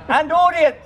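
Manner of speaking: high, projected street-show patter; street performer. Real, not acted. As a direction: pitch it high to reach the crowd. Street performer working a crowd.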